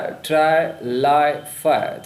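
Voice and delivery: try, lie, fire as separate words, vowel held; stressed vowel. Shown as a demonstration. Teacher picking out the rhyming sound, try, lie, fire held long. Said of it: "vowel sound का repetition है".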